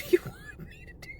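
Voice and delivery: high airy whispered plea; breathless helpless whisper; exasperated whisper. Acted. Worker to staring customer, whispered exasperation.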